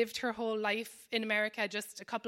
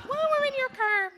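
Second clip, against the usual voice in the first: put-on much higher Irish accent, insisting on paying; 7 dB louder, faster than usual. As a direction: play the pushy Irish host grabbing the bill in an Irish lilt. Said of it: "the Irish person in me".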